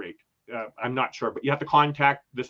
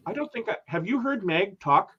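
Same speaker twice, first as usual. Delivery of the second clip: usual speaking voice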